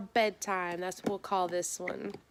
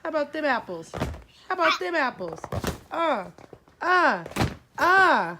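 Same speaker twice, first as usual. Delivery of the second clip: taunting; 7 semitones higher, 7 dB louder than usual. Acted. Taunting kid after being hit.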